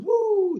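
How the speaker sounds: high sustained "woo"; whoosh sound. Retold or imitated. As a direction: imitate a sudden rush with a high held note. Imitating a sudden rush of energy.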